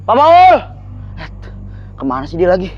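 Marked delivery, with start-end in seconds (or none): 0.0-0.5 loud high calls across the yard; calling out. Acted. Guard yelling for Pak Paul, loud calls. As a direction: shout his name to find him.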